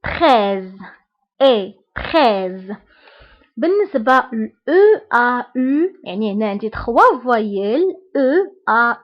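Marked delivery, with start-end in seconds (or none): 0.0-2.7 تخيز said as French treize, low and slow; teacher modelling تخيز as French treize; French word model. Shown as a demonstration. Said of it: "إكزامبل".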